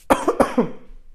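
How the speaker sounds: sharp cough clearing the throat; coughing fit. Real, not acted. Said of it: "se me fue la saliva por otro lado".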